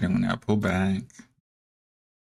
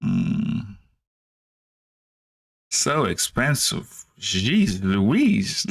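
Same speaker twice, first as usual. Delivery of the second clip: usual speaking voice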